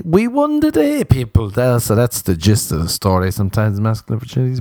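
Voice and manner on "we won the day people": mock triumphant. Mock triumph over fragility.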